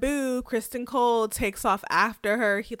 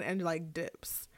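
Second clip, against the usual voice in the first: hushed aside as she slips away; breathy whispered dips; whispered exit; 4 semitones lower, 9 dB quieter, slower than usual. Retold or imitated. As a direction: whisper it under your breath. Rhaenyra's exit after sigh.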